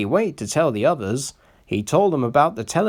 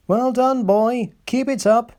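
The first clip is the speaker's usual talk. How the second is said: high, louder cheering on; encouraging; 12 semitones higher, 4 dB louder than usual. A narrator's reading. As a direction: urge the engine on happily. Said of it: "encouraged his driver".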